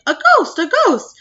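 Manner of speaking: high, loud panicked cry of ghost; crowd yelling. Retold or imitated. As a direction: scream it like a terrified crowd. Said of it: "with everybody yelling".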